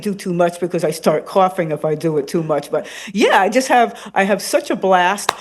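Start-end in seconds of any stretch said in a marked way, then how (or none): none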